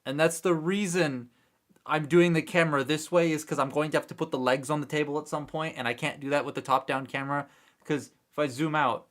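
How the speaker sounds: ordinary delivery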